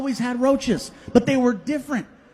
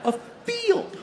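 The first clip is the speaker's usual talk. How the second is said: "A field" said much higher, laughing; disbelieving repeat; 12 semitones higher than usual. Shown as a demonstration. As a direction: push "A field" up high in disbelief. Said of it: "repeated in disbelief".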